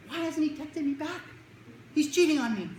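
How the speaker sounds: high-pitched mock teen distress; panicking teenage girlfriend. Teen waiting on boyfriend's text, high melodramatic panic. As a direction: imitate a dramatic teen, high and accusing. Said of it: "And they're like".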